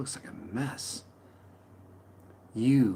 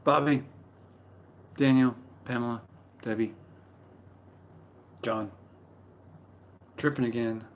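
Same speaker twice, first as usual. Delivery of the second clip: usual speaking voice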